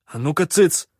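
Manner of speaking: loud, sharp command to hush; barking order. Acted. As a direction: bark it to stop the quarrel. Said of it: "рявкнул я".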